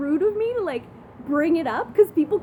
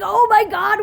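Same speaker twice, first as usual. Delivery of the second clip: loud, higher exclamation of disbelief; reliving a stunned outburst; retold shock; 6 semitones higher, 9 dB louder, slower than usual. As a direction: blurt it out in mock shock.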